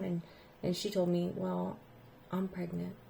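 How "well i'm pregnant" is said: very soft, small voice; hushed confession. Retold or imitated. Student confessing to teacher, barely audible. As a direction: say it so softly it almost vanishes.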